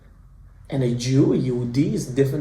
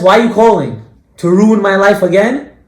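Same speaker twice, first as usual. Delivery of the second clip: loud, higher-pitched indignant challenge; angry accusation; 5 semitones higher, 11 dB louder than usual. Retold or imitated. Hurt woman answering her former date's call, angry and accusing. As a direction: confront the caller with raised voice.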